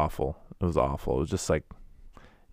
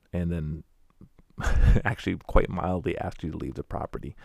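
ordinary talk, nothing marked

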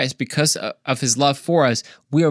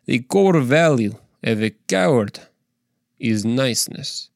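put-on Russian accent, measured delivery. Speaker retries his line for more impact, Russian accent. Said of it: "let me say that in Russian".

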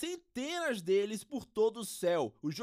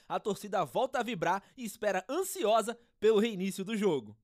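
ordinary talk

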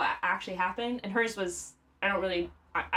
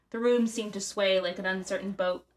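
ordinary delivery

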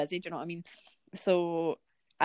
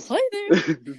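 laughing sorry; 2 semitones lower, 5 dB louder, much slower than usual. Retold or imitated.

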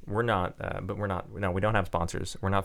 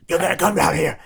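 loud, high, hoarse smoker's bark; raspy drill sergeant; 13 semitones higher, 7 dB louder than usual. Retold or imitated. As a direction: rasp out orders like a chain-smoker sergeant. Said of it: "he sounded like a pack-a-day smoker".

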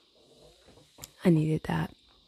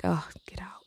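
whispered disgust; 9 dB quieter than usual. Retold or imitated. Reaction to coughing people.

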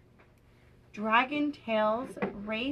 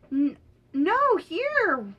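high, louder storybook objection; playfully cross character voice; mock snappish; 5 semitones higher, 6 dB louder, much slower than usual. A narrator's reading. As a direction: snap it lightly, pitched up. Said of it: "snapped Wheezy".

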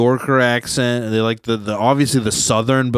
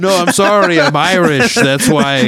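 put-on loud, higher joke accent sliding toward Southern; badly faked Irish accent; 7 semitones higher, 6 dB louder than usual. Joking excuse about hard R's, badly faked Irish accent. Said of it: "That's a Southern accent".

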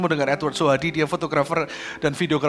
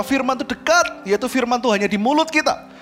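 higher, driving sermon voice; emphatic, raised-pitch exhortation; impassioned preaching; 6 semitones higher than usual. Real, not acted. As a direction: preach it with mounting conviction.

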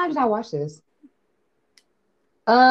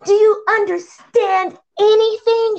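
much higher, slow, exaggerated scolding; mock gym teacher; 12 semitones higher, 4 dB louder, slower than usual. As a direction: talk down to them, drawn out.

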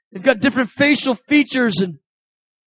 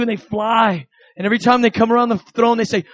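ordinary delivery

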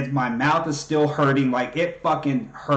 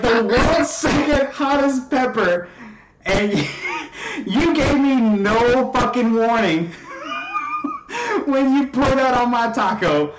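laughing outraged rant; 8 semitones higher, slower than usual. Retold or imitated. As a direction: rant in disbelief while laughing it out. Retold outburst about scorpion pepper.

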